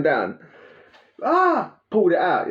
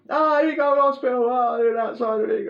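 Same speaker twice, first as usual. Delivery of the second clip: mock pleading; 11 semitones higher than usual. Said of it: "That's what he's done".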